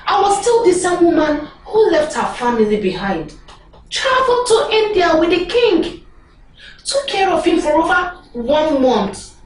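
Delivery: loud, rising indignation; indignant outburst. Acted. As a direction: complain hotly, voice climbing. Indignant outburst over no promotion.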